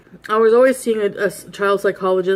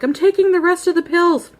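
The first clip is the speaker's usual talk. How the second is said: defiant; 10 semitones higher, 4 dB louder than usual. Retold or imitated. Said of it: "In the bathroom, I'm like".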